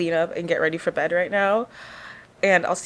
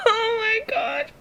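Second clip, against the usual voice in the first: real crying; 5 dB quieter, slower than usual. Real, not acted. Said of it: "I'm bawling my eyes out".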